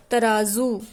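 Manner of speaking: "तराजू" as taraazu, with a buzzing z; teacher's clear taraazu for "तराजू"; z-sound demo. Shown as a demonstration. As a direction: carefully model "तराजू" with z in the last syllable. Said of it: "तराजू नहीं होता".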